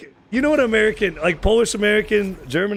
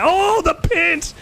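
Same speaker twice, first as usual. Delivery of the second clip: surprised; 12 semitones higher, much slower than usual. Real, not acted. Reacting to play in game.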